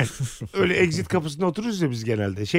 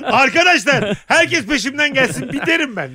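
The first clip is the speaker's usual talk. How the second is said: loud, high rallying call to the group; rally the survivors like a self-made alpha leader; hammed-up leader yelling to follow him; commanding leader shout; 8 semitones higher, 4 dB louder than usual. Playing take-charge leader on island.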